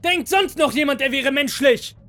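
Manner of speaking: very high, loud, angry yell; furious shout. A narrator's reading. Second master shouting furiously at the working androids. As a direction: yell a threatening challenge at everyone. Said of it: "schreit der zweite Meister wütend".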